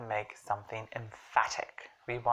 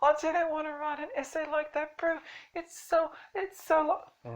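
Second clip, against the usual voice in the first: high-pitched mock whining; exaggerated high complaining imitation; whining students.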